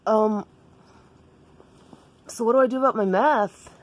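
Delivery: high, unsure character voice; Daniel. Acted. Daniel asks Jessica about math, high and unsure. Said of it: "And Daniel was like".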